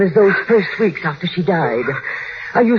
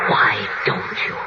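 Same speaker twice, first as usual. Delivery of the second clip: breathless whisper, low; whispered urging; 8 semitones lower than usual. Acted. Housekeeper whispering to the wife to jump. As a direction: tempt her in a whisper.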